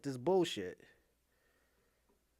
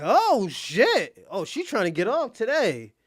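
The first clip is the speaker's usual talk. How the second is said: mock surprise; 3 semitones higher, 7 dB louder, slower than usual. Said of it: "We like".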